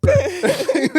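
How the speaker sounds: high, loud real laughter breaking out; burst of laughter. Real, not acted. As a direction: crack up laughing. Laughs after her own retelling.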